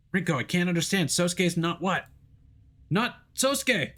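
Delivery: high, urgent, slowing at the end; frantic. Acted. Hero panicking as the call breaks up, high and urgent.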